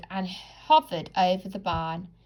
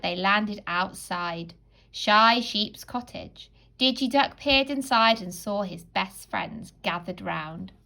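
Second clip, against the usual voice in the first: usual speaking voice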